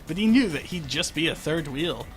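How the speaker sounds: ordinary delivery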